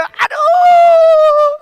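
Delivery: high, loud shout of pain; pain yelp. Acted. Preacher mimicking a painful burn with a yelp. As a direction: yelp like you were just burned.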